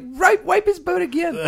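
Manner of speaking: high little-girl voice, imitated; toddler voice. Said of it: "She's like".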